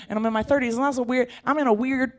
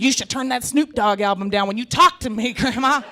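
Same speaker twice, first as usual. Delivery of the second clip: mock scolding; 4 semitones higher, 5 dB louder than usual. Scolding grandma, mock stern.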